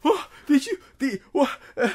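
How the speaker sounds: high, loud panicked yelps; panicking. Acted. Tavern keeper sees the dead bodies, panicked high yelps. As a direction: squeal in sudden horror. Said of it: "just starts, like, panicking".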